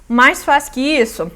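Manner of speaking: ordinary talk, nothing marked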